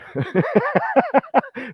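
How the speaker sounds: high-pitched burst of laughter. Real, not acted. Speaker amused by his own restaurant story, laughing high. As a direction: crack up at your own joke.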